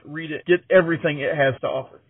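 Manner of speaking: normal talking voice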